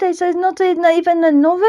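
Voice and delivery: ordinary talk, nothing marked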